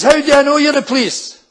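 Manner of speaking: high, loud challenge through a door; suspicious shout. Acted. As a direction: wee woman yelling her distrust.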